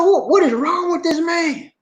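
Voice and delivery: high, exasperated retold cry; retold outburst. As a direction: throw it up high in disbelief. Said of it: "I said".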